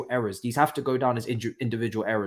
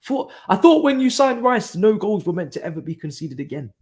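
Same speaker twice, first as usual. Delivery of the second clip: high, louder whiny mock fan voice; mocking Chelsea fan; 9 semitones higher, 7 dB louder than usual. Mocking Chelsea Twitter fans, high whiny mock voice. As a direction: sneer it like an entitled fan. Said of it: "the Chelsea Twitter guys ... go crazy".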